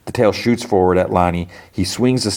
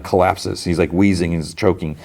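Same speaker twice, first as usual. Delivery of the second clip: ordinary talk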